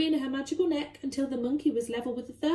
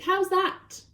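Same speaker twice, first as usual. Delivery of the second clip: pleased, high giraffe voice; a giraffe showing off; proud giraffe; 7 semitones higher, 4 dB louder than usual. Acted. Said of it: "said to the Duke".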